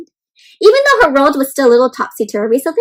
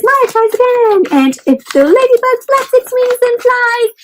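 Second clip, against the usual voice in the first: high, lively read of the card; bright read-out; 10 semitones higher, 4 dB louder than usual. Real, not acted. Teacher showing kids the ladybug craft, high and lively.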